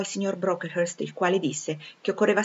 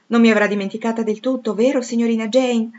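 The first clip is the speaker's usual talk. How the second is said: louder, higher hopeful question; eager appeal; 5 semitones higher, 7 dB louder than usual. A narrator's reading. A long-lost friend asks Jane to remember her, eagerly.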